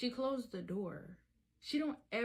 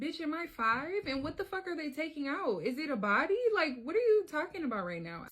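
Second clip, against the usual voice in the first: outraged disbelief; 6 semitones higher, 3 dB louder than usual. Real, not acted. Outraged reaction to mom.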